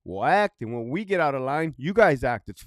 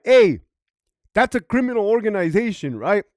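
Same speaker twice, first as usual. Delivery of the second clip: loud, high, pointed objection; pointed challenge; 5 semitones higher, 8 dB louder than usual. Retold or imitated. Police chief calling out the Hell's Angels, loud and pointed. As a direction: call them out hard.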